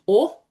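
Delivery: "or" said like a rounded "oh"/"aw". Shown as a demonstration. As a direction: model "or" as one open rounded vowel. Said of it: "sort of sounds like O H".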